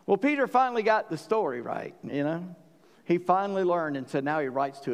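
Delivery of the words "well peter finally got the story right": ordinary talk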